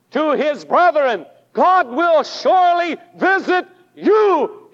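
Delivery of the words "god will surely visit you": higher and louder, ringing out "God will surely visit you"; full pulpit proclamation, rising on "God"; proclaimed loudly. Shown as a demonstration. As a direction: preach it out to the whole room. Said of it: "Hear it from God today".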